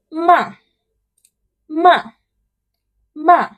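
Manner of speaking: MA short, high, falling sharply; fourth tone. Shown as a demonstration. Teacher showing the fourth tone, falling "ma". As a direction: throw "ma" down from high, like exclaiming. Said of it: "the fourth tone is when you say what".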